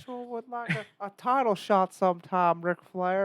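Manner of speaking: high, slow character voice; wrestler's challenge impression. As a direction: draw out the title request in a high put-on voice. Acts out wrestler challenging Ric Flair.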